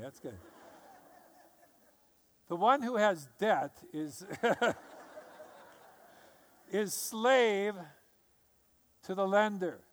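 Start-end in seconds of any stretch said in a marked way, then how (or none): none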